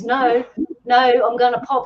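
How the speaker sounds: high, loud mock panic; high panicking voice. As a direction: imitate a panicking patient protesting loudly. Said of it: "his voice is a bit high".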